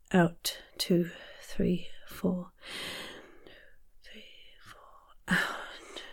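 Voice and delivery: breathy counting, fading to near nothing; breathing from the chest. Shown as a demonstration. Coach counts a chest-breathing exercise, whispery on the breath. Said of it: "From your chest".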